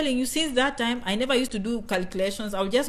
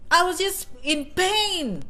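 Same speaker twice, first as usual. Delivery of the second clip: higher, slow hammed-up pain; slow, higher mock anguish over turning thirty; 7 semitones higher, slower than usual. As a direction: lament it like a tragedy, slow and higher.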